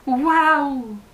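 loud, high wow of wonder; a girl amazed by the magic world. Acted. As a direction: gasp out a delighted wow. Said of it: "songea-t-elle".

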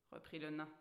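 plain reading voice

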